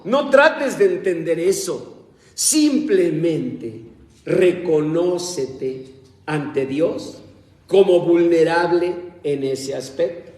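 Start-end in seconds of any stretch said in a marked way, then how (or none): 2.3-10.3 slow solemn exhortation. Acted. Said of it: "slowed exhortation".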